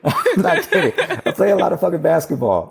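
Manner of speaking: laughing while he insists it's true. Real, not acted.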